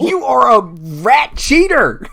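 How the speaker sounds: high, loud, drawn-out yell; furious name-calling. Acted. Luffy accusing her rival of cheating, furious yell. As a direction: stretch out the insult at full volume.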